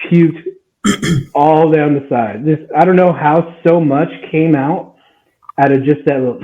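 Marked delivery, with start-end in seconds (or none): none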